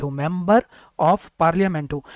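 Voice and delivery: member of parliament said slowly, starting on a clear m; slow demo phrase. Shown as a demonstration. Teacher sounding out an English phrase. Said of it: "तो मेंबर".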